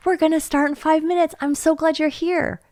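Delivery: put-on volunteer welcoming a child gladly; warm and attentive. Shown as a demonstration. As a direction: say it looking the child in the eye. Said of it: "You see the difference?".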